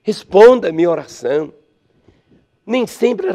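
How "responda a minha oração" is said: heartfelt cry. Acted. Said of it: "ele abre o coração e diz".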